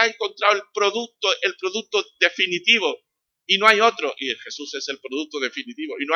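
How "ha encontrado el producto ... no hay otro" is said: louder and higher, hammering it home; emphatic proclamation. Real, not acted. Preacher to congregation, loud emphatic proclamation. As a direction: sell it like the ultimate product, full voice.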